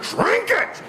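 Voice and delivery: high, strained cry; re-enacted high-pitched frantic demand; frantic command. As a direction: order it desperately while wrestling a thrashing dog. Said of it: "she's just thrashing. I'm like".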